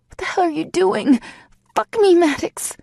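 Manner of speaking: high, loud outraged demand; frustrated yell. A narrator's reading. Heroine demanding Maddox continue, outraged and loud. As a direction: rage at him for stopping.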